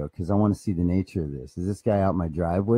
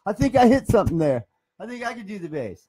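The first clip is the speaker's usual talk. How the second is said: much higher, louder squeaky buddy voice; Floyd's voice; 7 dB louder than usual. Acted. Floyd answers the guitar player in a high, loud voice. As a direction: pitch it way up as the excited pal. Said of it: "He said".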